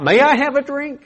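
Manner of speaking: high, polite asking voice; polite request. Pretend. Preacher contrasting a polite request, high and polite. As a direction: ask it sweetly and politely.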